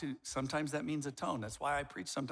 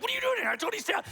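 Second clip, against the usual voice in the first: much higher, louder wife voice; mock scolding wife; 6 dB louder, much faster than usual. Wife catching him barging in, high mock scolding. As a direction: squeak out the wife's scolding. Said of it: "my wife's like".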